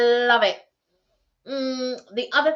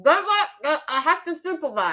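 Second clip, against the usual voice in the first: mouth full; 7 semitones higher than usual. Real, not acted. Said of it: "don't talk your mouth full".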